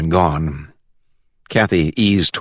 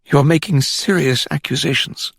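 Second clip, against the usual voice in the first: delicate manner; 8 semitones higher than usual. A narrator's reading. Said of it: "in his delicate manner".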